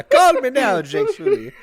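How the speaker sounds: high, loud psychic hotline Caribbean lilt, laughing; hammed-up Miss Cleo pitch; Miss Cleo accent. Friend riffs on the Na'vi girl as Miss Cleo, laughing. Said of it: "sounds like Miss Cleo".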